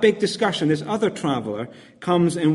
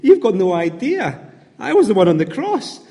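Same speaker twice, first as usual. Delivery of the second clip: higher and louder, playful wink of a voice; knowing Jesus, comedic; 5 semitones higher, 4 dB louder than usual. Acted. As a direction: play Jesus enjoying the private joke. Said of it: "And Jesus is going".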